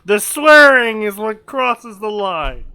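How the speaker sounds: loud, high-pitched indignation; mock outrage. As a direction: protest loudly as if scandalised.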